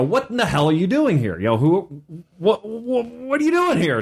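orc demanding, pitch climbing high; mock orc barging in; angry orc. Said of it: "this orc bursts in and goes".

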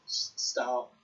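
ordinary talk, nothing marked

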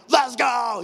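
high, loud pumped-up shout; hyped-up cheer; jazzed. Retold or imitated. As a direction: shout it like you're firing up a crowd. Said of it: "I'm, like, jazzed".

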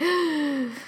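high, sharp intake of breath; re-enacted gasp of dread; alarmed gasp. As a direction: gasp as if in trouble. Reacting to dad hanging up.

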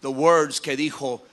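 ordinary delivery

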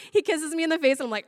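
normal talking voice

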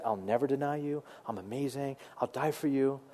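soft, self-important boast, lightly voiced; mock boastful Peter. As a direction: play proud Peter bragging, softly. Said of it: "he took himself way too seriously".

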